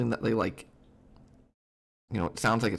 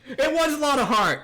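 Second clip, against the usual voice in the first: very high, loud burst of recognition; excited recall; 13 semitones higher, 5 dB louder than usual. Real, not acted. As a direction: blurt it out high as it comes back. Suddenly remembers the character's name.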